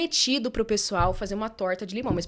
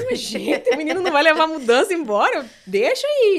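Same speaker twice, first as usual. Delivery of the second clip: exasperated; 7 semitones higher, 10 dB louder than usual. Retold or imitated. Her exasperated retold reaction.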